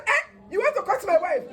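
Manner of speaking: high, loud disbelieving question; a husband aghast, voice jumping up; shocked husband. Retold or imitated. Husband protesting to the midwife, loud and high.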